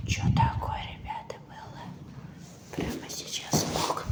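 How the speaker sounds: breathy hushed whisper. Real, not acted. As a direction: whisper so nobody hears. Hushed after a strange noise.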